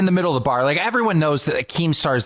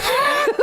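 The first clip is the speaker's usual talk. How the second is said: laugh. Real, not acted. Laughing at a fan story.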